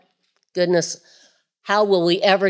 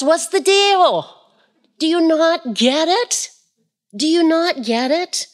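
Jesus in today's slang, exasperated; modern exasperated Jesus; 8 semitones higher than usual. Acted. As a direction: say it like a fed-up friend today. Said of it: "in today's language".

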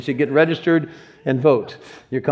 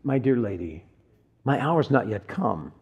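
softer, lower, tender address; respectful, gentle; 3 semitones lower, 5 dB quieter than usual. Retold or imitated. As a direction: gently imitate a kind, courteous reply. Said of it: "it's not a term of disrespect".

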